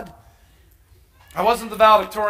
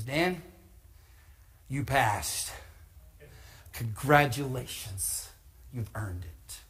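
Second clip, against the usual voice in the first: quiet congratulation; 6 semitones lower, 12 dB quieter, slower than usual. Retold or imitated. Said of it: "and he says".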